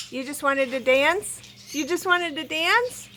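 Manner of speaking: ordinary talk, nothing marked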